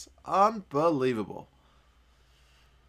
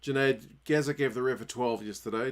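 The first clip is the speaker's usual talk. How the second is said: ordinary talk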